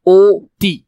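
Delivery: "অতি" split loudly into "ohh... ti"; drawn-out syllables. Shown as a demonstration. Teacher drilling how we say it, "অতি" as a loud, split "oh - ti". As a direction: stretch the "oh" of "অতি", then a separate "ti". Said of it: "আমরা বলি অতি".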